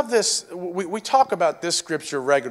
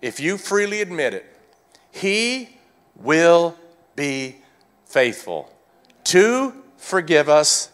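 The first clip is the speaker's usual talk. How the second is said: usual speaking voice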